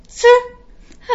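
a high, loud scornful puff; outraged snort of contempt; scornful pfuh. A narrator's reading. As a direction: blow it out in disbelief. Princess scoffs, outraged.

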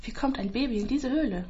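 usual speaking voice

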